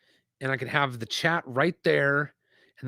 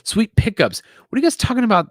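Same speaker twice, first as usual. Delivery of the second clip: excited; 9 semitones higher, 9 dB louder, faster than usual. Real, not acted. Reacting to chat, voice jumps up.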